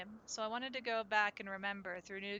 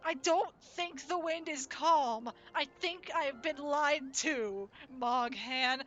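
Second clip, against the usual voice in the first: mock disbelief; 5 semitones higher, 6 dB louder, slower than usual. Mock doubt at the wind.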